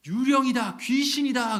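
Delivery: frightened shout. Acted. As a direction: scream out as if seeing a ghost. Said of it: "그렇게 외칩니다".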